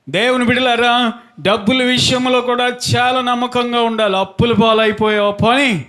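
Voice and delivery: high, ringing sermon delivery on a held note; preaching. Real, not acted. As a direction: preach it out, stretching phrase endings. Preaches to congregation.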